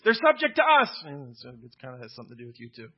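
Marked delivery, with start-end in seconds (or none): 0.1-1.0 "us" hit high and loud; emphasis on us. Shown as a demonstration. Pastor showing the congregation the emphasis on "us". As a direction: push "to us" up hard, showing the point. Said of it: "You see the emphasis?".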